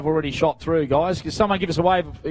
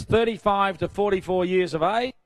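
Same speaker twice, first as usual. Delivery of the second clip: ordinary talk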